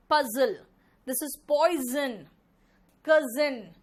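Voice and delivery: पजल, poison and कजिन with a buzzing z; loud, stretched-out model words, z in each; z sound drill. Shown as a demonstration. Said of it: "pronunciation".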